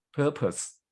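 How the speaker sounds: "purpose" with a strong PUR, falling after; PUR-pus, second syllable weak; stress on first syllable. Shown as a demonstration. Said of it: "at the first syllable".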